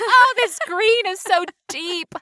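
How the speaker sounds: hammed-up starry-eyed marveling; lovestruck gushing. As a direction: swoon over mold as if it were a wonder. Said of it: "You're like".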